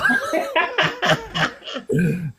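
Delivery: high laugh of delight; real laugh. Real, not acted. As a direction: burst out laughing at the memory. Laughs after telling son's line.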